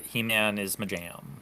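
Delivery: ordinary delivery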